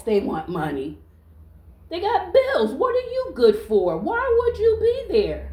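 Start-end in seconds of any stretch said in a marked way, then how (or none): 1.9-5.5 high, emphatic demand; indignant challenge. Real, not acted. Woman asking a freeloader what he's good for, indignant. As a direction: confront him with rising exasperation.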